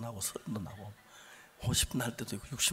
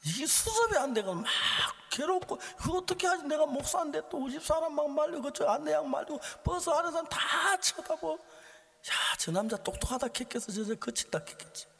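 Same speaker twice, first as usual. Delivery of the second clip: high, urgent retelling of inner panic; flustered, worked-up preacher voice; agitated preaching; 5 dB louder than usual. Acted. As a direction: pile up the worries at pulpit pitch. Preaches the turmoil before apologizing.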